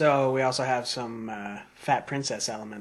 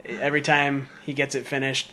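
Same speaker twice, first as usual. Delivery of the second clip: ordinary delivery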